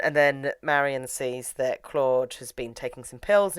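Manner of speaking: ordinary talk, nothing marked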